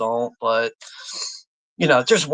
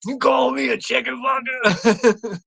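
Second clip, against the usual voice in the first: high, drawn-out indignant cry; mock outrage; 8 semitones higher, slower than usual. Quoting an insulted movie character, indignant. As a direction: quote it high and slow, hammed-up offended. Quotes an offended film character.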